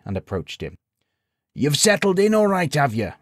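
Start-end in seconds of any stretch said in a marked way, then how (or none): 1.5-3.1 put-on high old villager voice; cheery old codger asking; crooked old codger. A narrator's reading. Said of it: "asked the crooked old codger".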